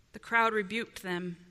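ordinary delivery

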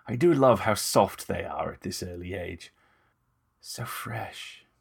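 lightly voiced vampire, savouring, fading to a near hush; lecherous vampire murmur trailing off; Moust, relishing vampire. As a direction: purr it with creepy relish.

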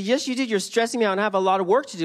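fast, high, loud retort; hammed-up busy customer; flustered reply. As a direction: rattle it off exasperated. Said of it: "I said".

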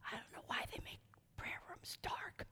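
quick, hushed aside; very soft, hurried whisper. Real, not acted. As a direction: whisper it fast, as a confidential grumble.

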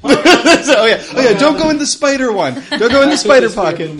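excited. Real, not acted. Warning about spider pocket.

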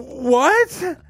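high, incredulous question; shocked reaction. Retold or imitated. Tenants hearing the neighbor's story, high shocked disbelief. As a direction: let it jump up in disbelief. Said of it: "We were like".

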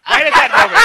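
high loud laughing words. Real, not acted. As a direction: laugh through the line. Laughing while saying.

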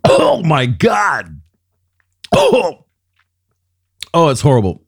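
gagging in disgust; retching after a foul taste; dry heaving. Real, not acted. Tasting the drink, disgusted.

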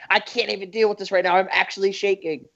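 mock Tumblr outrage. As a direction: play a mildly hysterical online poster. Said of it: "Everything would be in caps with".

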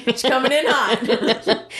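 slow, amused delivery through laughter; real giggle in the words; laughing. Real, not acted. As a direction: draw it out while cracking up. Joking, laughs on the line.

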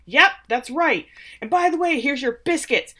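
hammed-up angry outburst, pitched high; retold yelling. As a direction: play Ted blowing up at his boss. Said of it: "yelling at her".

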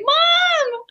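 high-pitched mock scream for mom; retold scream. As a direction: call out to mom in a high, drawn-out cry. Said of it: "I screamed".

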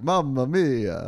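big, slow, loud Italian Mamma mia; hammed-up Italian exclamation; Italian accent. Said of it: "Italian, like".

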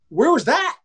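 high, indignant protest; mock outraged holler; imitated yell at umpire. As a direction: challenge the ump's call loudly. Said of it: "he yelled at the ump like this".

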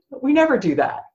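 usual speaking voice

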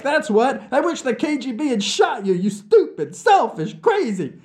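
high, loud tirade tumbling out; furious outburst. Acted. Nikita, sick of Vlad, lashing out at him in fury. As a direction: let the insults spill out at full force. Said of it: "Words tumbled out of me".

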